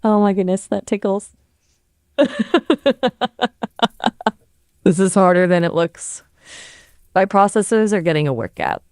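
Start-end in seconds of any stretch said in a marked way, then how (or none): 2.2-4.3 higher laugh keeps going; laughing on cue. Pretend. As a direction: push the laugh on a bit longer. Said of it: "See how long you can laugh right now".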